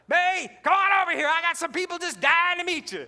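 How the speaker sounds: loud, high shout across a restaurant; hollering across the room. Retold or imitated. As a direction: call out to a coworker far away. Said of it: "she hollers".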